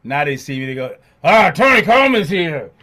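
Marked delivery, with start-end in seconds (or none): 1.2-2.7 loud, high, hammed-up groan of a welcome; teasing imitation of them now; mock grudging. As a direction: overplay the letdown as a joke. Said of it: "I'm only kidding".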